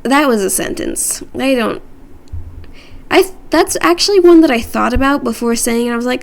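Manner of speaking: usual speaking voice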